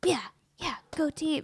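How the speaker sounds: light cheerleader impression; imitating cheerleaders. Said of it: "And they're like".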